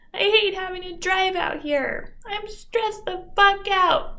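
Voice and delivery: high, whiny, self-mocking complaint; mocking self-pity. Speaker mocks her younger self complaining about hard life, high whiny voice. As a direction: whine it like a martyr, mocking yourself. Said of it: "I am slightly mocking myself".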